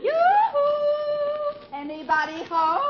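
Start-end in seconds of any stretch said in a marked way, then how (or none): 0.0-1.6 high singsong call through the house; a lilting high yoo-hoo from the door. Acted. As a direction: sing it out to announce yourself. Mother-in-law calling out.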